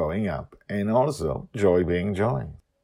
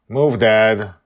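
curt, louder demand; rude; 5 semitones higher, 12 dB louder than usual. A narrator's reading. Joy ordering her dad away from the helm, rude. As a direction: brush him off rudely.

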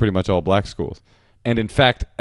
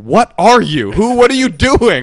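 baffled brother; 12 semitones higher, 9 dB louder, slower than usual. Retold or imitated.

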